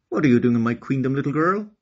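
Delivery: a queen scolding an intruder; queen yells at a girl at her gate, fast and loud; yelling queen. Acted. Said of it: "yelled the Crocodile Queen".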